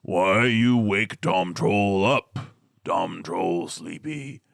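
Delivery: slow, heavy troll voice with dragged words; deep sleepy troll. Acted. Game master voicing a sleepy troll woken by players, slow deep troll grumble. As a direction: slur it out like a drowsy dim troll. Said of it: "this deep voice says".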